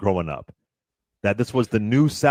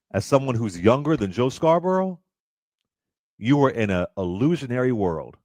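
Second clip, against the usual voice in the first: usual speaking voice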